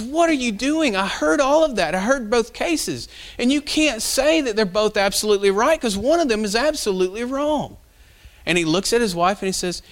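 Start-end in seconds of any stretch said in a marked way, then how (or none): 0.0-7.8 fiery mad. Retold or imitated. Town leader's wife scolding her husband over both verdicts, fiery mad. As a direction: storm in and tear into him, voice up high. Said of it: "comes in there fiery mad at him".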